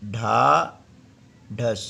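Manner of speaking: ढाढ़स stretched very slowly, sound by sound; drawn-out word. Shown as a demonstration. Scene: teacher writes and sounds out the word, very slow. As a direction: draw out ढाढ़स to show each sound. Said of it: "इस प्रकार से".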